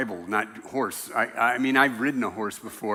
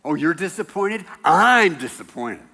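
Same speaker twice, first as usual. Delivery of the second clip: mocking, laughing jab that gets louder; sarcastic retort; 4 semitones higher, 7 dB louder than usual. Jesus pretending to snap back at grieving sisters, mocking. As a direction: throw their disappointment back with a laugh. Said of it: "He doesn't say".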